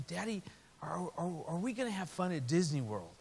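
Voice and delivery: put-on a worried kid asking her dad; hint of a timid daughter; small child voice.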